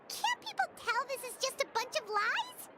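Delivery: usual speaking voice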